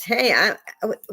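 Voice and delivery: high-pitched mock call-out; mock scream. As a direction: blurt it out as if announcing to everyone. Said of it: "it, it screams".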